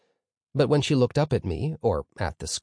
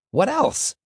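very high squeaked question; high-pitched squeak; 3 dB louder than usual. A narrator's reading. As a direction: squeak out "what else" at the top of the voice. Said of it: "came out in a high-pitched squeak".

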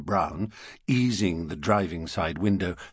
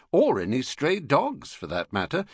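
ordinary delivery, nothing marked

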